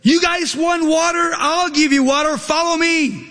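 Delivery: high, sharp preacher's mock yell at the crowd; hammed-up angry shout, pitched high. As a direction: snap at the people like a fed-up leader. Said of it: "he starts yelling at the people".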